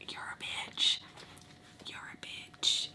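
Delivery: very soft, drawn-out whispered insult; voiceless contemptuous aside; acted whisper. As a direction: hiss it slowly under the breath. Mutters what she thought then.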